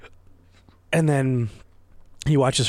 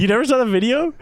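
surprised; 11 semitones higher, 5 dB louder, faster than usual. Real, not acted. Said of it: "What are you saying?".

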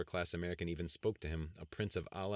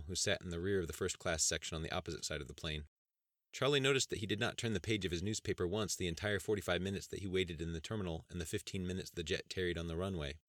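usual speaking voice

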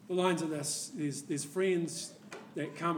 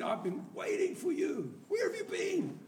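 eerie mock shout; 14 semitones higher than usual. Friend calling out from his bed to the intruder, high mock shout. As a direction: hail him like a ghost that was waiting.

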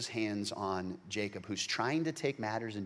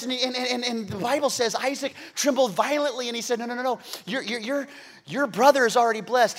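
much higher, loud impassioned sermon; preaching at full force; 13 semitones higher, 15 dB louder than usual. Acted. Preacher tells the stolen blessing to his church at full force. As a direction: drive it home to the congregation.

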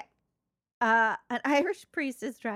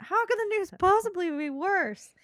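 higher, louder exasperated question; mock distress; 7 semitones higher, 6 dB louder than usual. Patient presses Doctor O'Mahony, mock distressed. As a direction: plead it as a hammed-up patient.

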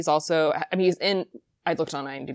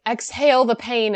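usual speaking voice

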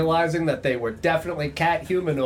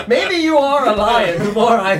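laughing; 8 semitones higher, 7 dB louder than usual. Real, not acted. Laughing in character.

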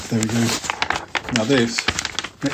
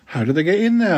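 put-on a boy's eager question pitched higher; higher schoolboy voice; 7 semitones higher than usual. Dick asking Tom what was stolen, higher boy voice. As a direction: ask it like a keen young boy. Boy character asks, read story.

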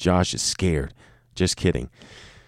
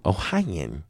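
normal talking voice

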